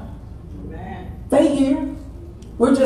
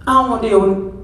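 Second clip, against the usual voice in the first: ordinary talk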